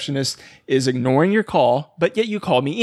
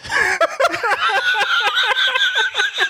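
big high-pitched laugh; high laughter. Real, not acted. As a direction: crack up laughing. Bursts out laughing.

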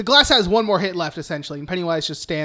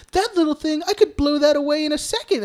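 high-pitched coaxing clown; Pennywise; 8 semitones higher than usual. Retold or imitated. Host retells the clown luring the girl, high and coaxing. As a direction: imitate a clown making light of it, voice well up. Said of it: "And he's like".

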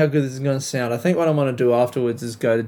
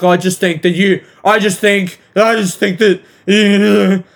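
higher, louder mock-complainer voice; mocking imitation; 5 semitones higher, 5 dB louder than usual. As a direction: mimic someone pushily repeating themselves. Mimics the complaining woman.

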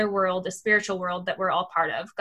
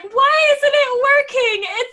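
high, loud, panicky mock fretting; freaking out; 12 semitones higher, 6 dB louder than usual. Host retelling her laptop panic to her cohost, high and frantic. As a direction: fret out loud like the laptop just died. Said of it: "kind of freaking out a little bit".

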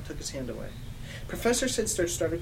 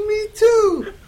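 high, loud squeal; shrieked; 12 dB louder than usual. Acted. New student finding out they share a house, shrieked. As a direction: shriek it in delight. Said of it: "I shrieked".

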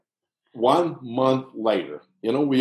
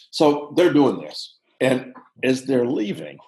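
ordinary delivery

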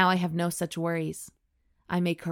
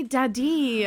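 higher, louder, drawn-out teasing plea; playful mock plea; 7 semitones higher, 5 dB louder than usual. Host jokes along with the steamy line, playful mock plea. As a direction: plead it teasingly, pitched up and loud.

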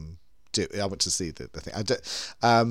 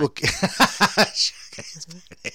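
laughing; 5 semitones higher, 5 dB louder than usual. Real, not acted. Repeats through laughter.

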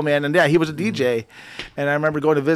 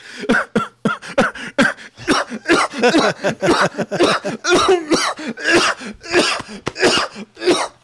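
imitated coughing fit; 11 semitones higher than usual. Dad coughing fit retold.

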